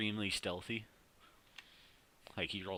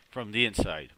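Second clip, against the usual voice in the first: ordinary talk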